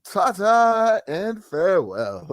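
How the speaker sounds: slow, drawn-out mock-posh farewell; grand farewell. Host mimics the arrested man's grand sign-off. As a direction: bid farewell like a lord. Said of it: "He signed off".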